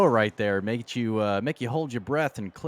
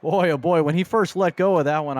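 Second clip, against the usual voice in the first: excited; 5 semitones higher, 5 dB louder than usual. Real, not acted. Color man reacting to near pick.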